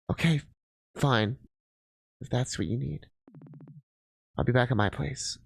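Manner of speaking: low, soft, resigned; dejected parting. Acted. Partner leaves Mel after quarrel, low and sorrowful. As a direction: give in sadly and quietly.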